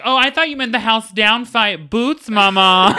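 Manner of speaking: high, loud drag-queen drawl; slow camp sign-off on mama; sassy phone assistant signing off to its owner; campy diva voice. Pretend. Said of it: "That's not even how I talk".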